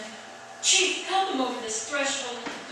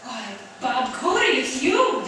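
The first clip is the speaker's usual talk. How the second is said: loud, delighted cry of surprise; surprised greeting; 7 dB louder, slower than usual. Acted. Mother greets chief at door.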